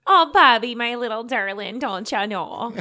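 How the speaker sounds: put-on sugary Minnesota accent mother voice. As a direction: coo it like a Minnesota mom. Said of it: "the Minnesota voice?".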